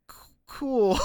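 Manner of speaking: high, stammered awkward cool; a sheepish stutter on cool. Retold or imitated. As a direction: stumble over it, caught out. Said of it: "I'm like".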